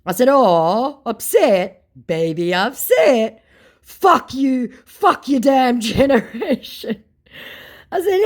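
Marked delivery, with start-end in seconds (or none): 0.3-3.4 high, loud, drawn-out teasing; mock him like an upset baby; baby taunt. 3.8-7.0 high, loud, slow cursing; mock scammer fury. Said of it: "he's so angry right now".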